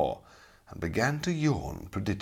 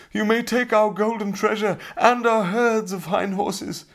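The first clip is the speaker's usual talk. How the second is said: much higher and louder, terrified begging; pleading cry; 13 semitones higher, 5 dB louder than usual. A narrator's reading. Kneeling robbers beg the knight to spare them, terrified. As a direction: beg for your lives in panic. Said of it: "they cried".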